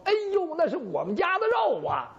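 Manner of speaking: sudden high cry of recognition, stress on 肉; shocked mother-in-law, 肉 landing hard and falling; startled recognition. Acted. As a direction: gasp it out as you realize 肉 is yours. Mother-in-law recognizes her meat.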